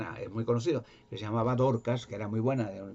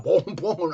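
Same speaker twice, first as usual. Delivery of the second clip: whip sounds; 6 semitones higher, 7 dB louder than usual. Retold or imitated. Lashing sounds retold.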